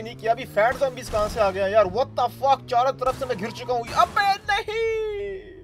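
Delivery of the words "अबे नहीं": high drawn-out no; panicked cry. Real, not acted. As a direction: wail it in dismay. Surrounded by zombies. (घबराकर लंबी चीख)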